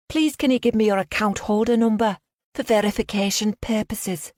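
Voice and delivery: high, put-on Liverpudlian accent. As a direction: fake a Liverpool bank caller. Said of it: "I put on a strong Liverpudlian accent".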